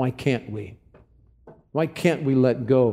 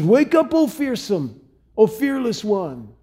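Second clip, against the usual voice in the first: David mocking the drowsy Abner; taunting; 8 semitones higher, slower than usual. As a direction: jeer him awake. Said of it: "He just taunts him. He chides Abner".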